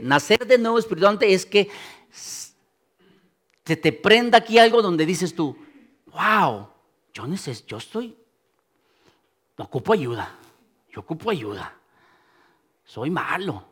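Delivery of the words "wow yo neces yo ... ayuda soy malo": soft, low admission; humbled confession. Acted. Preacher voices someone meeting God, hushed humbled admission. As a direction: act out a dawning realisation, voice dropped.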